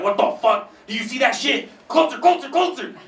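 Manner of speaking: imitating enraged drivers, high-pitched and urgent; mock frantic tailgater yelling; aggressive road rage. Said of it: "people get so fucking aggressive".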